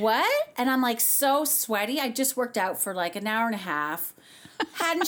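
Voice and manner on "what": high, rising mock-incredulous cry; retold shock. Singer hearing she must go on, retold shock. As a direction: react as if you can't believe it. Reacting to the double's request.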